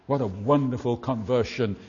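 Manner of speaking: ordinary delivery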